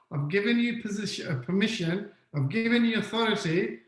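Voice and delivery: ordinary talk, nothing marked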